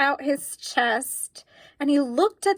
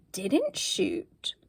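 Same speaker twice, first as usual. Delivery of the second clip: hushed, higher whisper; whisper of relief; 7 semitones higher, 5 dB quieter than usual. A narrator's reading. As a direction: whisper it with wonder. Relief, surprise.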